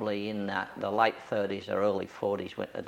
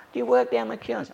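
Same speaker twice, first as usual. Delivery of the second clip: much higher, louder query; mock surprised cop; 12 semitones higher, 5 dB louder than usual. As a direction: sound caught off guard, high and loud. Cop's surprised question.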